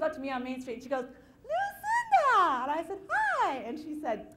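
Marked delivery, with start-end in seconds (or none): 1.4-2.6 high, loud, drawn-out call of the name. Retold or imitated. Lady on Main Street calls her by name, high, loud and slow. 3.1-3.6 high, loud cheery greeting; bright hi. Retold or imitated. New girl greets the lady, high and loud.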